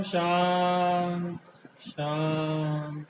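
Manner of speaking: slow meditation voice with long pauses; hypnotic, stretched-out vowels on the calming words; guided relaxation. Real, not acted. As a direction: draw out each soothing word, lulling the body to let go. Leads a guided body relaxation.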